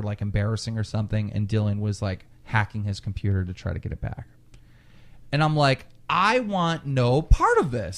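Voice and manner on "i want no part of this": slow, high-pitched "I want no part of this"; retold emphatic refusal. Friend backing out of Dylan's hacking, emphatic "I want no part of this". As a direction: hit "I want no part of this" word by word, drawn out.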